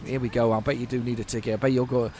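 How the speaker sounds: ordinary delivery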